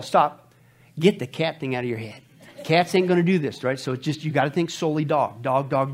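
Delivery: ordinary talk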